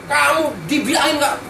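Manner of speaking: doctor mock scolding a patient who won't listen. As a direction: scold the stubborn patient, drawn out. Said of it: "Saya punya hak untuk ngomelin dia".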